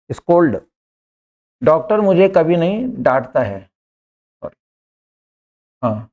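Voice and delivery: usual speaking voice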